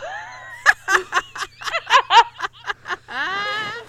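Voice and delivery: laughing till it hurts; breathless wheezing laugh; helpless laughter. Real, not acted. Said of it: "It hurts".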